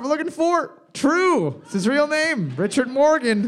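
high, eager answer reveal; excited reveal. Real, not acted. Quiz host revealing the true answer, excited. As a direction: announce the answer with a lift.

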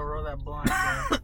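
excited shout. Real, not acted. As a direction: yell it out hyped.

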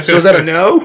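normal talking voice

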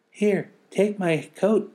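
put-on very high, loud, slow child voice; child offering coat. Friend giving the girl his coat, high child. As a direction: hand it over eagerly. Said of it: "one of them said".

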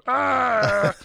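high, loud mock animal roar; animal growl. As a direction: let out a cartoon animal roar. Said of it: "why does every animal go".

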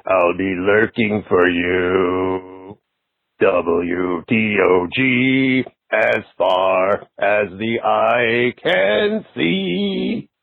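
very slow, drawn-out horror host sign-off; lightly ghoulish, savor every word; Doctor Paul Bearer impression. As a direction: horror host signing off to Derek, slow and spooky. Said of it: "I did a spot-on impression of Doctor Paul Bearer".

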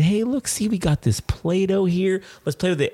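put-on high, sing-out coaxing of a child; brightly distract an upset little kid. Dad offering crying daughter the Play-Doh, coaxing.

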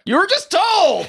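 tiny squeaky voice; high squeaky voice. Acted.

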